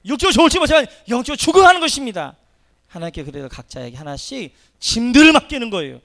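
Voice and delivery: loud, high fervent sermon; full-force preaching. Real, not acted. Preacher urging the congregation, at full force.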